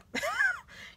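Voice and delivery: sudden high laugh; high burst of laughter. Real, not acted. Laughing after swearing off people who don't listen, a high burst. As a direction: laugh off your own outburst.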